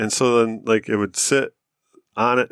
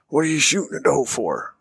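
angry dad; 5 semitones higher than usual. Retold or imitated. Angry dad voiced in story.

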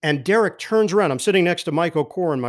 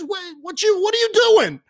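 much higher, a mock surprised yelp; surprised question. Tipsy teammate surprised to see the broadcaster, mock yelp. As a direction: act thrilled and baffled to see him.

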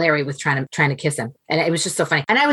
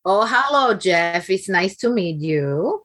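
drawn-out, gracious Dominican mom greeting; imitating Dominican mother; 3 semitones higher, much slower than usual. Mother meeting the interviewer, slow Dominican accent. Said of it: "now I feel like I know her".

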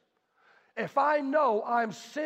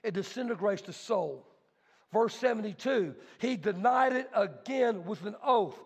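usual speaking voice

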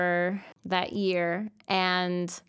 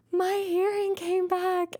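overwhelmed; 13 semitones higher than usual. Retold or imitated. Retold reply to mom.

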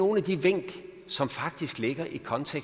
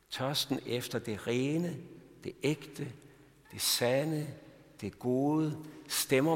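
slower and softer, an intimate appeal; softly inviting the congregation to come and drink; gentle invitation; 2 semitones lower, 3 dB quieter than usual. Acted. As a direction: draw the listener in quietly. Quiet appeal to the listener.

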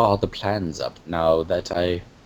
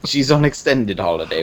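ordinary talk, nothing marked

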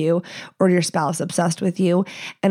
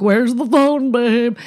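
mock prank acting; 3 semitones higher, slower than usual. Imitating couples staging phone pranks, mock innocent. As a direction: play a badly acted prank on your partner.

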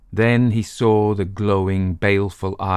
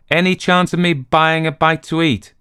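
higher, louder, polite stranger; Dayton voice; 9 semitones higher, 5 dB louder than usual. Acted. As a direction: ask the barman cheerfully. Stranger asks barman.

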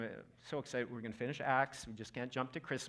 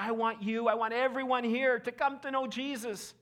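higher, louder earnest appeal; passionate plea; 10 semitones higher, 7 dB louder than usual. Retold or imitated. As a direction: urge everyone to come to Jesus. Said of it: "he said".

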